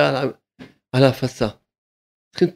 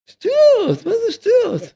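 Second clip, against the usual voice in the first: high mocking voice. Rabbi scoffing at those arguing over two hundred shekels, high and mocking. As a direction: jump way up into a high, incredulous mocking pitch.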